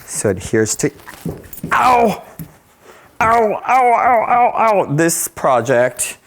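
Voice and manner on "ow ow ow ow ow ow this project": high pained ow, drawn out; real sting of a hot burn. Real, not acted. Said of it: "burning me".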